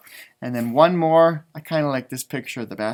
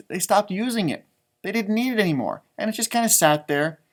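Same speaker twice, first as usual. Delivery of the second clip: ordinary delivery, nothing marked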